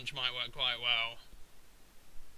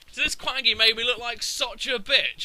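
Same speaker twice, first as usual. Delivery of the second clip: ordinary talk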